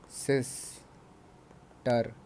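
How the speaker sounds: सिस्टर split slowly into सिस and टर, with a long gap; syllable by syllable. Shown as a demonstration. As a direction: say सिस्टर in two separate beats. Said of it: "जिनको हम दो बार में बोलते हैं". (सिस्टर को दो हिस्सों में तोड़कर, धीरे-धीरे)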